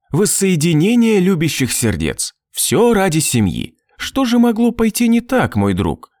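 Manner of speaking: high, taunting lilt dropping at the end; mocking. A narrator's reading. Tormentor taunting his victim about family, mocking. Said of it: "протянул Балябин издевающимся голосом".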